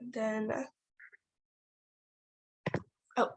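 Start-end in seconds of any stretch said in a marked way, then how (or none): none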